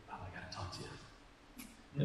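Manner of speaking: hushed imitation of a New Jersey accent; very soft, near-whispered New Jersey accent. Pastor quoting a late church friend, New Jersey accent. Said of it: "In her beautiful New Jersey accent".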